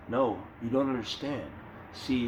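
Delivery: low, mock-hypnotic condescension; hypnotic persuasion. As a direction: imitate someone trying to hypnotize, pitch dropped. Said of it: "it's like trying to hypnotize you".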